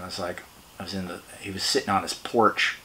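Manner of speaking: ordinary talk, nothing marked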